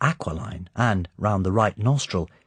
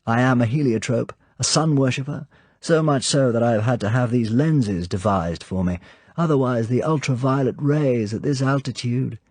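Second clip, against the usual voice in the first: high, louder, animated villain's welcome; the Count's voice; 5 semitones higher, 4 dB louder than usual. Acted. Count welcomes Sir Hilary, light animated high voice. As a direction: greet the guest lightly, full of animation.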